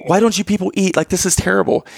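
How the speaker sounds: high, incredulous mock outrage; comic disbelief at people who don't eat; exasperated complaint. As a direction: throw it at the whole family. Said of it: "I'm like".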